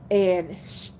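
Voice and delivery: ordinary delivery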